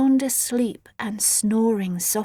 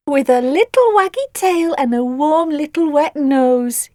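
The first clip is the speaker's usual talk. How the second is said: put-on baby talk; 5 semitones higher, 6 dB louder than usual. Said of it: "continued Nellie".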